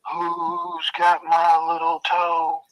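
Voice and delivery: slow, drawn-out eerie moan; haunt the line like a ghost hunting its toe; ghostly voice. Retold or imitated. Dead man searching the house for his lost toe, slow ghostly moan. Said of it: "and I hear it".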